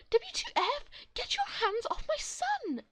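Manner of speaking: high loud shout, slowing at the end; furious mum. Acted. Mum catching the woman about to spank her son, shouting. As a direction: yell to protect her son. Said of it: "my mum walks in".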